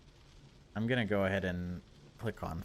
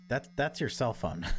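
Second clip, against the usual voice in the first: ordinary talk, nothing marked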